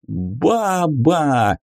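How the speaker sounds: a rowdy gang's bellow; roaring shout. A narrator's reading. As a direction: bellow it like hungry brutes. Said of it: "взревели еретики".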